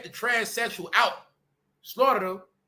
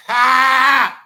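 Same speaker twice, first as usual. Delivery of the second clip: loud mocking ha; 4 semitones higher, 11 dB louder than usual. Real, not acted. Mocking outburst at failure.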